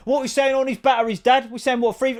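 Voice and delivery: high, loud bartering lad; mock car boot haggling; hammed-up son to dad; imitating the son. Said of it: "they talk exactly the same".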